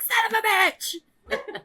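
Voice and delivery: high, stretched-out swearing; comic pained cussing; acted labor curse. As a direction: curse it out long and high through a laugh.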